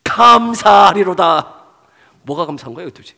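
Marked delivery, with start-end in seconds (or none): 0.0-1.6 joyful thanks. Acted. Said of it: "바로 이십오 절에 뭐라고".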